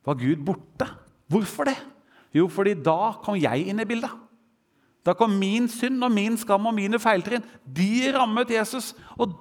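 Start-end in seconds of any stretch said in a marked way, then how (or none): none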